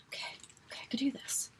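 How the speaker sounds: barely audible, breath-only pep talk; self-calming whisper. Real, not acted. Vlogger trying to calm down, self-calming whisper. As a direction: whisper it to yourself.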